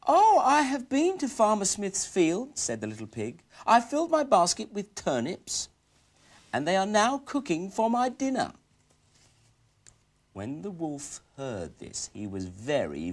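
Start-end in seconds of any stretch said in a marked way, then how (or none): 0.1-8.5 high, loud cheeky piggy voice; little pig voice. Acted. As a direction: boast like a clever little pig.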